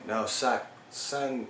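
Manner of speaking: normal talking voice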